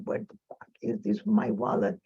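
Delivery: father's curse in a near-whisper; retold cursing. Father hunting for his lost wallet, hushed muttered cursing. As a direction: mimic him cursing under his breath, almost voiceless. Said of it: "he was cursing and going around the house".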